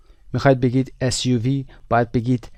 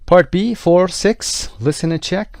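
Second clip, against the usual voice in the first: higher, louder, slow announcing of the task; textbook instruction read; 7 semitones higher, 6 dB louder, much slower than usual. Acted. Teacher reads the exercise heading aloud to the class. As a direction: announce the exercise like a course audio.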